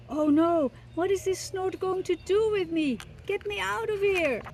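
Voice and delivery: high, alarmed chick; frightened baby bird. Acted. Baby bird carried off by the snort, high and scared.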